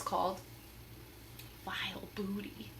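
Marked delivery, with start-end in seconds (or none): 1.6-2.7 spooky whispered title. Real, not acted. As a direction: breathe the title mysteriously.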